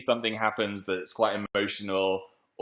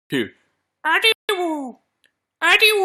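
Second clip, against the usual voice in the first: very high drawn-out cooing call; squealed name call; 5 dB louder, slower than usual. Pretend. Host christening the emu, squealed name call.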